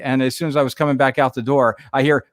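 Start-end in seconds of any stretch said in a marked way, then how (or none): none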